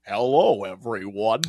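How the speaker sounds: low, measured joke impression; mock-grave self-introduction; Tom Hardy's supposed normal voice. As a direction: put on a deep, odd actor's voice. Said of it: "His normal voice is probably like".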